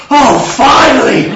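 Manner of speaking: high, loud, drawn-out outburst; acted relief. Joseph cleared at last, hammed-up shout of relief. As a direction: let out huge mock relief.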